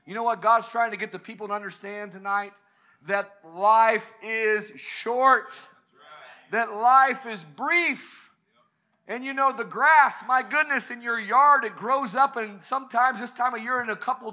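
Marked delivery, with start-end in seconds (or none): 3.1-8.3 slow, every word set down; solemn, slow sermon point; slow, weighty. Acted. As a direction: let it sink in slowly. Preacher slows for key point.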